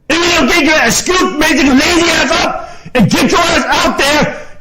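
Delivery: real furious screaming, pitched very high; high, strained angry yelling; upset screaming rant. Real, not acted. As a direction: scream at them in outrage, dragging out the insults. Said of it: "I should not have to get upset at people".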